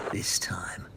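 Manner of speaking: hushed, secret whisper. Acted. Joel whispering his plan to himself, hushed.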